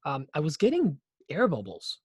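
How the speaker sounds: ordinary talk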